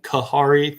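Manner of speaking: "Kahari" with an h sound for the J. Shown as a demonstration. Gardener admitting an old mistake, "Kahari" with J as H. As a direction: demonstrate the old mistake, "Kahari" with a breath of H mid-word. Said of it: "thinking the J would sound like an H".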